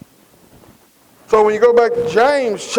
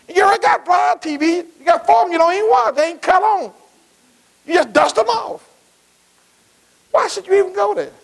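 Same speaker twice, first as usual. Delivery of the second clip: full force preaching; 8 semitones higher than usual. Acted. Preacher to the congregation about flat screen TVs, full force preaching. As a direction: drive it home to the congregation.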